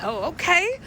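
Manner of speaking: high, surprised okay; a hint of surprised delight; pleasantly surprised. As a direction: react to the huge bag of weed. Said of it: "I was like".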